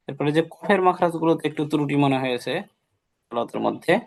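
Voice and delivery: normal talking voice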